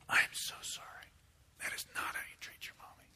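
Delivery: hushed, contrite whisper; whispered apology. Acted. Daddy apologizing to his kids for how he spoke, whispered. Father apologizing after messing up.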